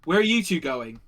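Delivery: loud demand, punched at the start; acted soldier calling out; soldier's challenge. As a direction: challenge two passers-by as a guard. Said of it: "he's like".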